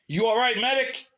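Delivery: loud, high call into the hut; hollering. Acted. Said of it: "he hollered into the hut".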